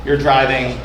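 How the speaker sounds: ordinary talk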